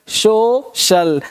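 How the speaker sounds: सोशल very slowly as "so... shal", with sh; corrected pronunciation of social. Shown as a demonstration. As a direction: stretch सोशल into two separate syllables, sh in the second. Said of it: "इसको सोशल नहीं पढ़ना है".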